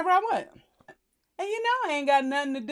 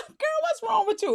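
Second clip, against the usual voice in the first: high-pitched, laughing teasing question; laugh, then ask it high and jokey; playful laughing take; 11 semitones higher than usual. Acted. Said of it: "you don't know how, how she meant it".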